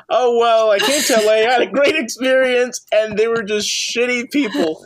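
hammed-up mimicry of a friend's complaint; mocking impression. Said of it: "and you're like".

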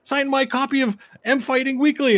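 high, excited clamoring; eager fans. Acted. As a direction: beg for an autograph. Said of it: "And they're like".

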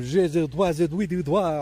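jokey French gibberish; mock French. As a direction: rattle off pretend French. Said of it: "all French stuff".

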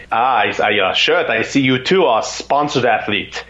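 slow, put-on German accent. German triathlete greets a fellow racer at the pier, German accent. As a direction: imitate a German athlete, careful and slow. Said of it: "it's this German accent".

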